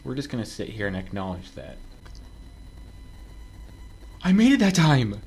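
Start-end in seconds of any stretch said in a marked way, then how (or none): 4.2-5.2 loud, higher cheer of success; thrilled, raised-voice exclamation; triumphant cry. Real, not acted. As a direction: shout it out in triumph. Cheers clearing a jump.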